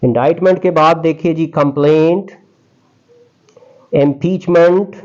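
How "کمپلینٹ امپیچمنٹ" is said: slow, higher, deliberate word list; careful word-by-word list recital; vocabulary list reading. Shown as a demonstration. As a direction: announce each word to the class. Reads antonym list.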